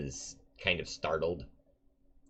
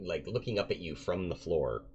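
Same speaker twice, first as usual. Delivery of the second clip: usual speaking voice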